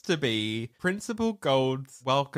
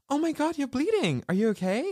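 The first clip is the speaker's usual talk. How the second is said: put-on girly concern; faster than usual. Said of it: "she's like".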